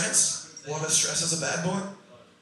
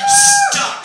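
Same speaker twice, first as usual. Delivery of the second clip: high cracked squeal through laughter; laughing squeal; 11 dB louder than usual. Real, not acted. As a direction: let the word burst out in a laugh. Laughing outburst on his own word.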